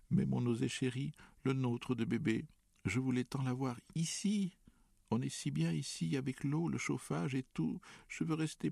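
high, whining plea near tears; whimpering. A narrator's reading. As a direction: whine it like a tearful girl. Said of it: "pleurnichait-elle". (pleurnicher d'une voix aiguë)

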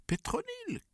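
very high cry of the name; delighted greeting. A narrator's reading. Delighted greetings at family reunion. (exclamation ravie, très aiguë)

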